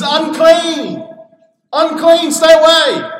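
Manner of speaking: loud, high cry of Unclean; a leper's desperate shouted warning. Retold or imitated. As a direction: shout it to keep people back. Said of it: "what you had to cry out to people".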